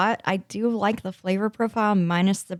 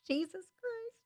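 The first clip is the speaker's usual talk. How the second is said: laughing; 16 dB quieter than usual. Real, not acted. Laughing at own mumbling.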